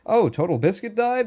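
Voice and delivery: high, surprised question; mock surprised. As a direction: ask it in sudden shock. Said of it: "And then I bring up".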